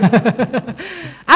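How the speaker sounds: short bubbling laugh. Real, not acted. Preacher amused at quizzing the congregation, laughing. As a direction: laugh briefly after your own question. Laughs after asking about opening words.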